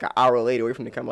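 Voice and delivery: ordinary talk, nothing marked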